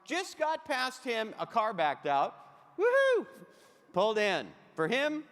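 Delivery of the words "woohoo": high, loud celebratory woohoo; gleeful whoop. Acted. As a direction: whoop it up, far above your talking pitch. Celebrating a car backing out.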